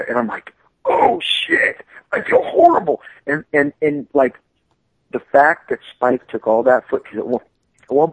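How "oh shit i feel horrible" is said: high breathless whisper of dread; panicked whisper. Retold or imitated. His panicked inner reaction.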